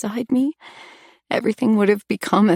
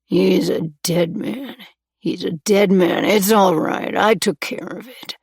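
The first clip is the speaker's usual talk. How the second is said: rambling under breath. A narrator's reading. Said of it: "still rambling under his breath".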